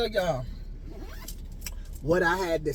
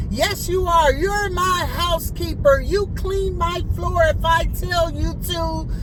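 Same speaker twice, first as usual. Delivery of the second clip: high, loud, commanding mock voice; hammed-up imperious client; mock bossy client; 10 semitones higher, 4 dB louder than usual. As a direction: order her around in a high shrill-free loud tone. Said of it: "client bossing her".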